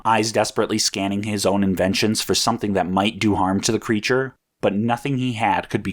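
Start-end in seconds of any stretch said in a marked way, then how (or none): none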